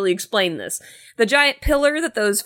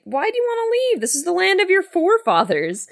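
father voice; 10 semitones higher, faster than usual. Retold or imitated. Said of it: "he's like".

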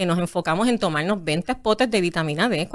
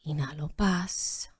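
soft, slow meditation cue; hushed breathing guide; yoga teacher voice; 5 semitones lower, 10 dB quieter, slower than usual. Shown as a demonstration. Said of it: "en tu voz de maestra de yoga".

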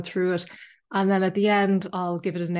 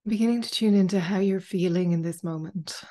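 calm meditation guide, long pauses between phrases; unhurried, spacious guided-meditation delivery. Acted. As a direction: ease listeners into stillness, phrase by phrase. Leads a guided meditation after chat.

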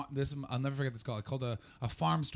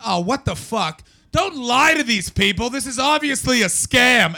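loud, much higher indignant shout; outraged yell; 11 semitones higher, 13 dB louder, slower than usual. Retold or imitated. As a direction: blurt it out at full volume. Outburst at lying colleague.

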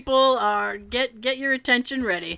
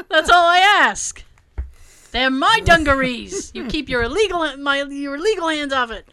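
mock indignant; 4 semitones higher, 4 dB louder than usual. Mock protest over her jeans.

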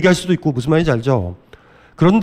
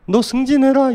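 pushy wife; 9 semitones higher than usual. Retold or imitated. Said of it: "이런 건데".

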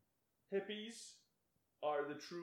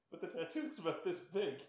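ordinary talk, nothing marked

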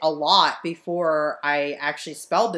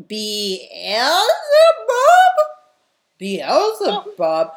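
slow puzzled "Beelzebub?" drawn out with a rise; mocking a stranger stumbling over "Beelzebub"; confused mock asking; 11 semitones higher, 8 dB louder, much slower than usual. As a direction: imitate someone baffled by the name. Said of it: "people are like".

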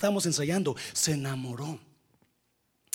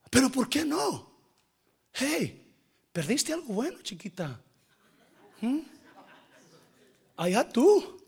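taunting; 8 semitones higher than usual. Pretend. Taunting the ex-girlfriend.

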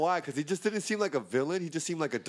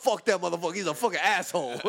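imitating [name]; 5 semitones higher, 4 dB louder, faster than usual. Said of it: "D M X called him out".

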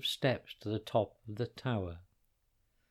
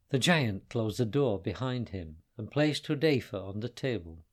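ordinary delivery, nothing marked

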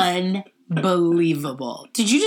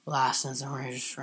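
cop voice; 4 semitones lower, 8 dB quieter than usual. Retold or imitated. Cop voice at traffic stop.

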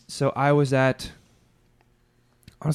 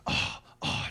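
frustrated; 8 dB quieter than usual. Retold or imitated. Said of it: "just very frustrated".

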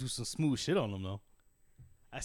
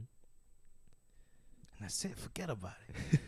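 put-on softly spoken Mexican-accented advice; Latin accent; 2 semitones lower, 9 dB quieter, much slower than usual. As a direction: wrap it up quietly in a rough Latin accent. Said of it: "I can't do the accent very well".

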